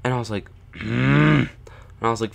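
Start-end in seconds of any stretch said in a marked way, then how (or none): 0.7-1.5 drawn-out mock-grudging "mm"; reluctant closed-mouth groan. Kid pressured by dad to ski down, reluctant groan. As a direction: grumble wordlessly, as if giving in. Reluctant reaction to dad's urging.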